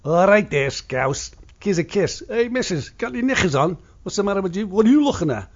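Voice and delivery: high, loud Liverpool Scouse banter; Scouse greeting. Retold or imitated. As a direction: hail them like a cheeky Liverpool lad. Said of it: "I'm just gonna do a blanket".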